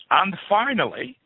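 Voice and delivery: slow, high dramatic news sign-off; newsreader voice. Shown as a demonstration. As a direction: announce it like a TV anchor. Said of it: "do you like that?".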